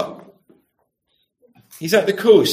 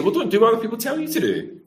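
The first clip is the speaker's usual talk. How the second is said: quick, higher mock-parent scolding; parent's retort; 6 semitones higher, 3 dB quieter, faster than usual. Parent answering a child's excuse, quick high-pitched retort. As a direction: reel it off like a parent. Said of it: "What do your parents say to you?".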